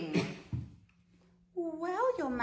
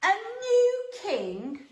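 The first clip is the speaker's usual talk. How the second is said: indignant surprise; 11 semitones higher than usual. Acted. Herod outraged at rival king.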